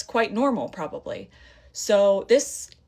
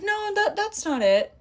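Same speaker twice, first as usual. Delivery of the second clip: much higher, flustered agent voice; mimicking the agent; 13 semitones higher than usual. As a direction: squeak out the agent's denial. Agent voiced in retold call.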